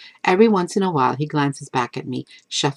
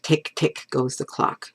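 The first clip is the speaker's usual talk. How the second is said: ordinary delivery, nothing marked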